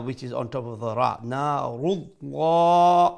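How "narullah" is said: Narullah chanted very slowly with a heavy, rounded lla; long sustained Narullah; full mouth. Shown as a demonstration. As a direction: fill the mouth on the L and hold the last aa of Narullah. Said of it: "with a full mouth".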